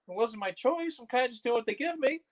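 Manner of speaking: tiny high-pitched excuse; meek inner protest. Retold or imitated. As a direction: squeak it softly, like a timid thought. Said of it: "eh, in my head".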